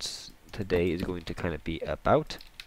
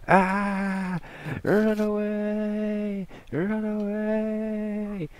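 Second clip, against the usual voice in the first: hammed-up high panicked shrieking; bad acting; much slower than usual. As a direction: shriek it in overdone fake panic. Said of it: "excuse the bad acting".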